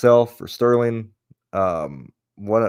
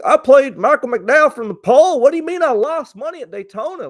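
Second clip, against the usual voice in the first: much higher, louder mock dumb-guy voice; mocking clueless player; 8 dB louder than usual. Mocking clueless DFS players who whine about losing, high mock whine. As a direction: sneer it like an idiot whining. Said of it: "there's idiots out there, you know, that are like".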